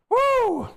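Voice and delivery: high sustained "woo"; whoop. Real, not acted. Whoops after drinking some water.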